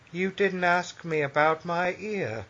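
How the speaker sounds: put-on psychopath's plaintive reproach, drawn out; Simcoe. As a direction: needle your wife in a slow, eerily light voice. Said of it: "full Simcoe on her in public".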